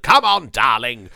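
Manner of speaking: loud put-on actor's voice; John Hurt. Trying to place a voice, loud mimicked actor. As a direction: do a loud, rough impression of an actor. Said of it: "A little bit John Hurt, I suppose".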